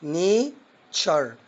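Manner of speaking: "نيتشر" said very slowly as "neitʃer"; very slow teacher demo; slow model word. Shown as a demonstration. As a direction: draw out "نيتشر" so learners can repeat it. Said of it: "حاول تنطق معايا".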